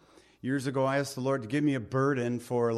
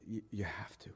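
urgent whisper; 5 semitones lower, 13 dB quieter, faster than usual. Real, not acted. Preacher pressing the congregation, in an earnest whisper.

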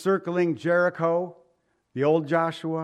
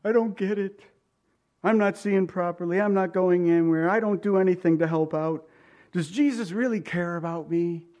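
mock complaining; 3 semitones higher than usual. Roadside sufferer doubting Jesus cares, complaining. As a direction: grumble like someone feeling sorry for himself.

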